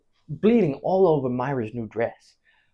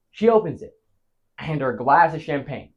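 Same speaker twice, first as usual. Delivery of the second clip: normal talking voice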